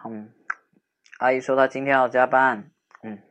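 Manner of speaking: put-on playful bantering young man walking her home; teasing boyfriend-type friend. Teasing friend walking upset girl home, playful banter. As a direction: tease her, then soften and comfort.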